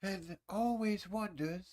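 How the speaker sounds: slow, lightly voiced nature-documentary narrator; a hint of a hushed wildlife narrator drawing it out; documentary narrator impression. Mocking the documentary narrator he loves, slow and hushed. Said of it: "the guy that talks like this".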